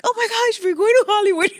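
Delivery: retold excitement. To herself at seventeen, thrilled about Hollywood, squealing. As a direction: relive the teenage thrill. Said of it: "I was so excited because I was like".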